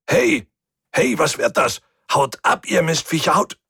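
very high, alarmed yelling; shouting. A narrator's reading. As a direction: fend off attackers with frantic shouts. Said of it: "Ich hörte ihn schreien". (panisch geschrien)

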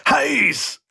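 high pained yelp; cry of pain. A narrator's reading. Mechanic touches hot metal, crying out in pain. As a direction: cry out as if burnt. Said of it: "diesmal allerdings vor Schmerz".

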